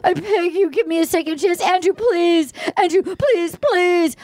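high mock-tearful begging; hammed-up weepy plea; imitating sobbing pleas. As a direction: plead as if crying. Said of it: "And she's sobbing".

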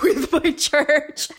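words breaking into a high laugh; real laughing. Real, not acted. Woman confessing she worshiped in a bikini, laughing. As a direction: laugh through it at your own absurdity.

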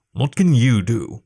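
slow, understated sneer. A narrator's reading. Captain doubting his crewman's offer, slow sneer. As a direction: sneer it slowly, unimpressed. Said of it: "Blacktail sneered".

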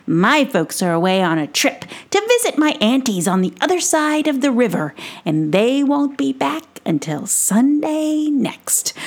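high, excitable little gnome voice; excitable Brisbane. Acted. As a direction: squeak it out like an eager tiny adventurer. Brisbane explains his freedom.

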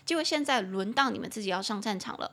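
ordinary talk

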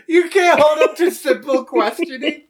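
high, giggling through it; laughing. Real, not acted. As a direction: laugh while teasing. GM laughing at player.

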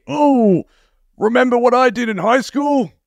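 high, wistful washed-up dad voice; Al Bundy. Retold or imitated. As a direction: reminisce like a has-been dad. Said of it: "Fuck off, Al Bundy".

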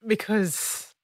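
tearful, fading almost to nothing; choked up and very soft; after crying. Real, not acted. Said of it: "Sorry, I've been crying".

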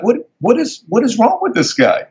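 high, stammering disbelief; incredulous, pitch leaping up; retold bafflement. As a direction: act out being baffled by dad. Retold bafflement at dad's outburst.

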